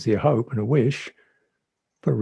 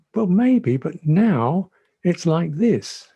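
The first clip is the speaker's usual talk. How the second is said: slow patient answer; 5 semitones higher, 4 dB louder, slower than usual. Retold or imitated. Calm answer to complaint.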